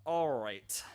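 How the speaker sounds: usual speaking voice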